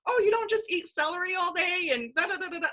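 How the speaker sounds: high, excited gushing comment; gushing fan. Retold or imitated. Followers gushing over her pizza post, high and excited. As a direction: squeal it like a delighted follower.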